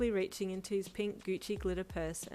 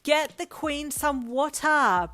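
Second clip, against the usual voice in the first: much higher, much louder, slow holler; 8 semitones higher, 12 dB louder, much slower than usual. Acted. Brian shouting out the window for help, holler. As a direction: yell it out the car window. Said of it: "Brian hollered".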